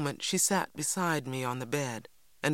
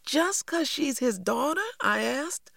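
put-on very high child's question; incredulous; 12 semitones higher, 3 dB louder than usual. A narrator's reading. Cassie asks Mama about Mister Sims's reasoning, incredulous.

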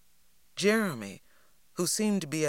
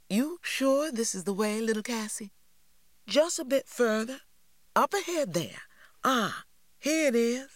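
Lily's voice; 8 semitones higher than usual. A narrator's reading. Lily and Cassie high voices.

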